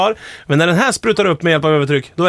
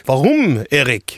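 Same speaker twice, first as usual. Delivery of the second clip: asking in mock German; 3 semitones lower, much slower than usual. Acts out friends asking why in various languages, mock German. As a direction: put on German for the question. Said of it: "på olika s-, språk".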